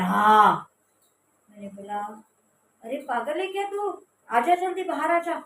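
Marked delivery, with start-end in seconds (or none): 0.0-0.7 loud, unfamiliar-sounding yes; one blunt word, shouted; curt yes. Retold or imitated. Sister confirming the cold bath, curt and loud. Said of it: "उसकी आवाज़ बहुत भारी लग रही थी". 2.8-5.4 higher, louder urgent plea; urgent scolding. Retold or imitated. Narrator urging sister out of cold water, loud.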